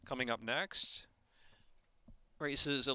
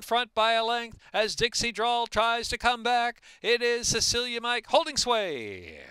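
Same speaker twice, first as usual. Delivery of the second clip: steady play-by-play building to a loud, excited finish call; live race call; 9 semitones higher, 8 dB louder than usual. Real, not acted. Race caller calling the field home for bettors, building to a loud finish. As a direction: call the race, rising as they hit the stretch.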